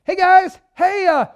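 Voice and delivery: high, loud, chummy greeting; mock casual Jesus. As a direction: call out like an over-eager buddy. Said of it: "this is not just Jesus going".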